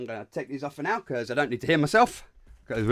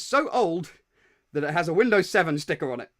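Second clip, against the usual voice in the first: laughing; 8 semitones higher, 7 dB louder than usual. Real, not acted.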